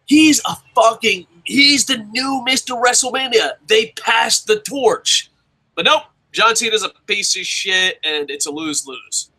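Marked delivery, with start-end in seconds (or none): 0.1-8.0 fired-up rant. Real, not acted. Host arguing a wrestler should be the new star, fired-up. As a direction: hammer it home, slowing at the end. Heated rant on what could have been.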